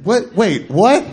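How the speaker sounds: high, disbelieving string of questions; mock bewilderment, pitched well up; baffled. Customer on the phone failing to understand the stranger, baffled. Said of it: "couldn't wrap his head around".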